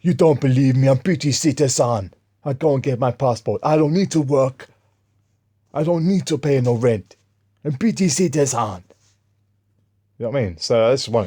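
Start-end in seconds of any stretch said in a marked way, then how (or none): 0.0-7.2 loud, raised mock African-accented protest; higher, louder, hammed-up anger in a put-on African accent; imitated angry rant. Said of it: "this African guy started getting angry". 7.6-8.8 higher, mock-indignant African accent; hammed-up angry declaration; imitated angry insistence. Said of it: "this African guy started getting angry".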